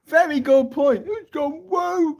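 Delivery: high, slow, crying mock voice; mock wailing whine. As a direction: wail it like a hammed-up victim. Mocking the X crowd.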